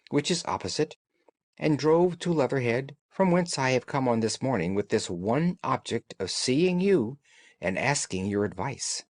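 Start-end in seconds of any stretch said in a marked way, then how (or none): none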